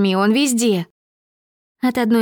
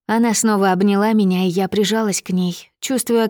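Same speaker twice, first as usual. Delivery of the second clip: usual speaking voice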